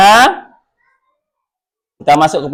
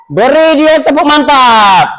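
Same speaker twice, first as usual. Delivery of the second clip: higher, more excited call to applaud; 9 semitones higher than usual. Real, not acted. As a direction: cheer the class into clapping with extra energy. Teacher calls class to applaud.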